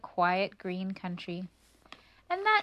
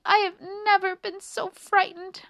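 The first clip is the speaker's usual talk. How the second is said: high, loud, lightly acted sob; tearful little mouse, storybook style; sobbing; 11 semitones higher, 6 dB louder than usual. A narrator's reading. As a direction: wail about the fright. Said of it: "sobbed Annie".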